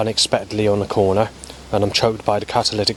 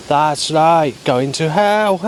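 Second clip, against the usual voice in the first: higher and louder, a cawing bird mocking; mock seagull voice; 6 semitones higher, 4 dB louder, much slower than usual. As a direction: voice a seagull jeering at you. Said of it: "Then a seagull says".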